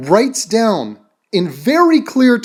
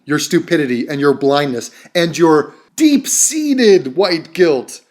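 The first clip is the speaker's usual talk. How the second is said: ordinary talk, nothing marked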